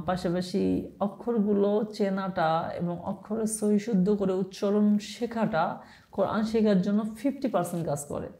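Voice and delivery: ordinary talk, nothing marked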